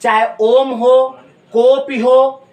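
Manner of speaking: drawn-out om. Shown as a demonstration. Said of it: "ह्रस्व का तीन गुना समय लगता है".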